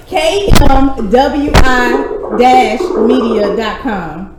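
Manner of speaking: very slow, spaced-out spelling of an address; deliberate, slow-motion dictation; slowly spelled out. Real, not acted. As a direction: give each letter and word its own beat. Spelling out web address for caller.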